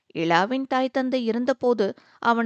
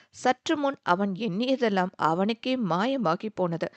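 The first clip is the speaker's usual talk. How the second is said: usual speaking voice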